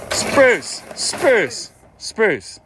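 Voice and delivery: high sing-song call of the goat's name. Real, not acted. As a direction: call him from across the pen. Calling goat repeatedly.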